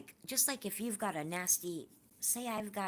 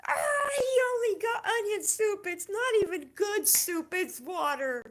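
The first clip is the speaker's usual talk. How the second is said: much higher, louder hammed-up crying shout; mock crying wail; 9 dB louder than usual. Retelling her crying at the movie, hammed-up sobbing shout. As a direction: wail it out like a blubbering movie fan. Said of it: "I bursted out crying".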